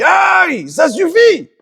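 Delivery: high, pained mock yelp; Esav crying out. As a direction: squeal like a beaten man. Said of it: "il crie". (Esav qui crie, voix aiguë de douleur jouée)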